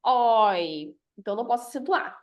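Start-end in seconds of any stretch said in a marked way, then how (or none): 0.1-1.0 "Oi" drawn out as one open syllable; isolated strong syllable. Shown as a demonstration. Teacher answering which syllable is strong, Oi held long. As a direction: hold the open diphthong in Oi. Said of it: "Qual que é a sílaba forte".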